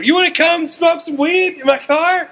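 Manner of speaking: high-pitched, overeager invitation; excited shouting. Retold or imitated. Fan invites departing star to his car, excited shouting. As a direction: blurt the offer in a very high voice. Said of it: "I don't even realize I'm shouting".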